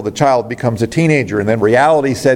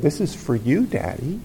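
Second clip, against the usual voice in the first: put-on little girl, softly; 3 semitones higher, 8 dB quieter than usual. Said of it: "the little girl ... said".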